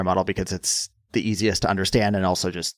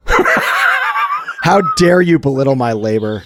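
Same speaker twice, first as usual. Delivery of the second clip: loud, slow, high theatrical indignation; hammed-up outrage breaking into laughter; mock outrage; 8 semitones higher, 7 dB louder, slower than usual. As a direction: declaim it like an offended orator. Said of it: "Calm down, Keith Olbermann".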